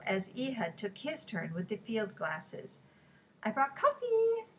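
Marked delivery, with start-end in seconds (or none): 3.4-4.5 high, loud sing-song call; cheerful. A narrator's reading. Ellie greets the men with coffee, cheerful. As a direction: chirp it out brightly, last word held high. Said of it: "she said cheerfully".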